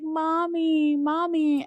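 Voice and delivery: high, loud wail for mother; acted-out child's plaintive cry; imitated crying child. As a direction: imitate a distressed little girl calling out. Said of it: "like, crying".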